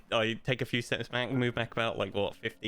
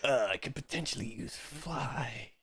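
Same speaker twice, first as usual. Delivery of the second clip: noir detective voice; 4 semitones higher, slower than usual. Pretend. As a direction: muse it like a hard-boiled detective. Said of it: "I'm doing it in my detective voice".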